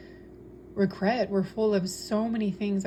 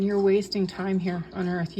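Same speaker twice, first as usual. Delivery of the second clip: ordinary talk, nothing marked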